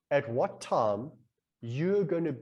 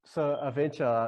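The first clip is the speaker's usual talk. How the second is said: plain reading voice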